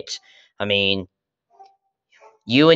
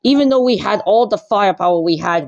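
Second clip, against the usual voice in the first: high, loud, strained monster-king rant; furious villain king fuming over defeat; angry Bowser; 12 semitones higher, 5 dB louder than usual. Acted. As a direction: rage about the lost battle at the top of the voice. Bowser rages after losing.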